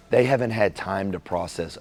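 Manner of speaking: ordinary delivery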